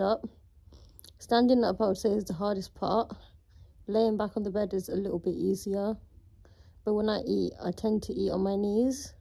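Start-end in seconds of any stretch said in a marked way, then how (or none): none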